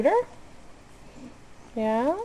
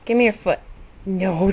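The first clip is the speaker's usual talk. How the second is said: ordinary talk, nothing marked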